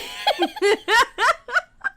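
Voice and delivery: very high helpless laugh; shrieking laugh. Real, not acted. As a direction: laugh until it squeaks. Laughing at the joke.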